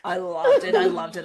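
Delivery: can't contain how much she loved it; gushing delight. Real, not acted. Gushing about the book.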